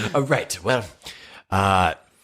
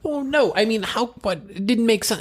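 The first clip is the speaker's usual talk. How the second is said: high, flustered denial; flustered squeak. Acted. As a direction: caught out, voice jumps way up. Flustered excuse in character.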